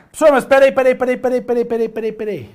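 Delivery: a hint of an eager student cutting in, quick repeated peraí; student interrupting. Student voice interrupting the professor, lightly acted and quick. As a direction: play the pupil stopping the teacher.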